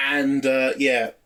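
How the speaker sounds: ordinary talk, nothing marked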